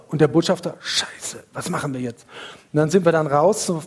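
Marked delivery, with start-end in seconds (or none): 0.8-2.3 panicked whisper. Retold or imitated. Ambassador panics at the bar.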